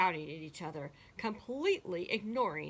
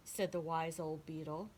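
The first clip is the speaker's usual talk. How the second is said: ordinary delivery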